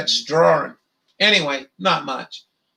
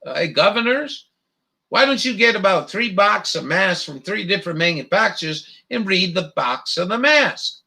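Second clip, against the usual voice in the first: usual speaking voice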